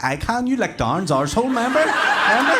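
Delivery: high, loud mocking glee; rising, triumphant teasing; gleeful taunt. Comic taunting drunk mates with glee, high and loud. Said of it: "I bounce in like".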